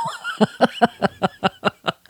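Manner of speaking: high amused laugh; embarrassed giggle; laughing at herself. Real, not acted. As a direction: laugh at the bad chant. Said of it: "I sound like a goat".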